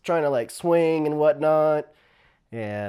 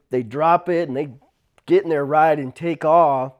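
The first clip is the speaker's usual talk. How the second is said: ordinary talk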